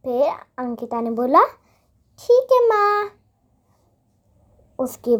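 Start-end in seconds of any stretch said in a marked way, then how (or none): 2.2-3.1 high, small obedient reply; meek little girl. Acted. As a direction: give in sweetly like a child. Girl agrees with mother.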